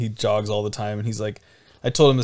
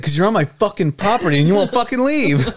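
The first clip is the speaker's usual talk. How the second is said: louder, higher, exasperated outburst; angry retort; 9 semitones higher, 5 dB louder than usual. Retold or imitated. Neighbor ordering drunk off his property, angrily. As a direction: act out a neighbor losing his patience. Acted quarrel, neighbor losing patience.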